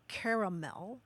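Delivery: caramel as CARE-uh-MEL; three-syllable caramel, full ending. Shown as a demonstration. Host listing US variants for caller, caramel as CARE-uh-MEL. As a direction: say caramel with EH first and a clear MEL last. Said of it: "some people say".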